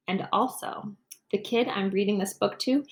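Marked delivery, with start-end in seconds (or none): none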